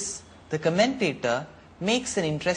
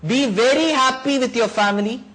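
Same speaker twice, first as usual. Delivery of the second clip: higher and louder, sarcastic farewell; scornful blessing; 8 semitones higher, 6 dB louder than usual. Acted. The sage loudly hurls a sarcastic blessing at the king. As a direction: throw the blessing at him.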